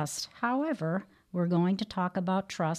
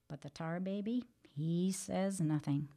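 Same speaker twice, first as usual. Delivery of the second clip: quiet refrain; 8 dB quieter than usual. A narrator's reading. Refrain after each outburst.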